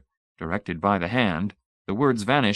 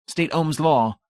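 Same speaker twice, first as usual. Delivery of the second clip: high, crisp demand; zealot's question; 8 semitones higher, 3 dB louder, slower than usual. Acted. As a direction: put the question proudly. First question.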